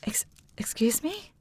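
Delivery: faltering, hushed disbelief; stunned. Acted. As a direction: stumble on the first word in shock. Sister hears of disappearance.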